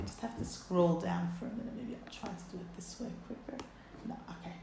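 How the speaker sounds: quiet aside while scrolling; muttering aside. Real, not acted.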